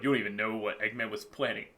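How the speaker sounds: quick, low, hushed hint of menace; dark sinister voice. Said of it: "it was a dark, sinister voice going".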